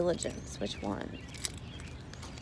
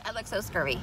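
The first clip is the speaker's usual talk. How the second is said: normal talking voice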